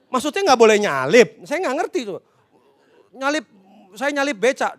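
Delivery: usual speaking voice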